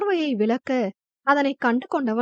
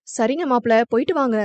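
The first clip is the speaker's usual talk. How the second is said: usual speaking voice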